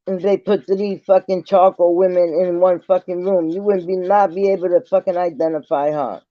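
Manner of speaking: ordinary talk, nothing marked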